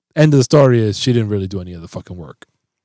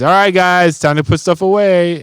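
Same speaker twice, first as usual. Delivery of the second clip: louder, higher call across the house; calling the kids; 6 semitones higher, 4 dB louder than usual. Retold or imitated. Dad calling the kids to put groceries away, a raised call.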